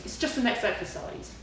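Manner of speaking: ordinary delivery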